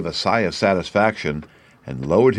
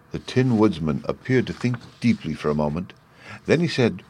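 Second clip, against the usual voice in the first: usual speaking voice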